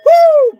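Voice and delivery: high loud woo; party whoop; excited whoop. Real, not acted. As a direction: let out a big joyful whoop. Excited whoop while singing.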